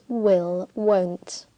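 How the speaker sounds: usual speaking voice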